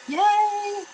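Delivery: high, bright cheer of delight; excited yay. Real, not acted. Crafter celebrating her resin project to viewers, high excited cheer. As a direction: cheer it like the craft finally worked.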